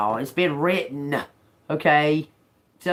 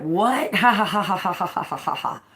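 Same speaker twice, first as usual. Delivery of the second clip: mocking laughter; 5 semitones higher than usual. Town mocks scrawny David.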